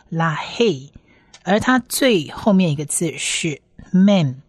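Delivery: usual speaking voice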